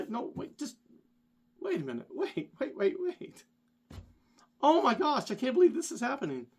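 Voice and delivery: breathless high-pitched panic, rushing the end; pleading with the world to stop; frantic panic. Retold or imitated. Friend spiraling in panic.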